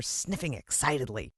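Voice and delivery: usual speaking voice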